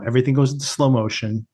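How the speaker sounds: usual speaking voice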